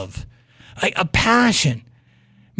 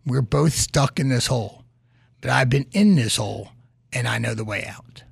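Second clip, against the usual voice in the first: calm reassurance; 6 semitones lower than usual. Retold or imitated. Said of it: "smiled and said".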